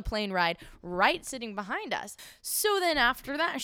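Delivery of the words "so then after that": ordinary delivery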